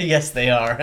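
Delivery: ordinary talk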